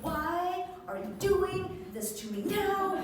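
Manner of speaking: high-pitched mock plea to God; hammed-up desperate praying; pleading prayer. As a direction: plead upward in comic exasperation. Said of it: "I start pumping and praying".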